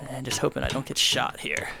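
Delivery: hushed whisper under fire; whispering while hiding. Real, not acted. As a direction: whisper so nobody hears. Tense firefight, hoping not to be heard.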